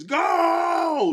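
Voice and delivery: high, loud wailing ghost cry. Pretend. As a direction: wail it like a haunting ghost. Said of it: "in my ghost voice".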